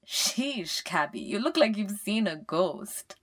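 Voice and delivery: lightly voiced cheeky brother; teasing brother. A narrator's reading. Brother teases stunned sister.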